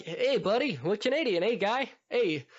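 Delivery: put-on teasing take on a Canadian accent; mock Canadian. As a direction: lightly parody a cartoon Canadian. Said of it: "and they're like".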